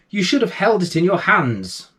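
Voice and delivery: a mild scolding mother. Acted. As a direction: tut at him like a tired mum. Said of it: "said his mother, shaking her head".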